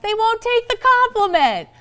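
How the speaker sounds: a stunned report to a friend; exasperated disbelief. Retold or imitated. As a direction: complain that nobody will take your kind words.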